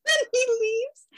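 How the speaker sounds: high, slow, wobbly "then he leaves"; laughing through mock tears. Real, not acted. Finishing the train story with a teary laugh. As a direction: let the laugh-cry carry on.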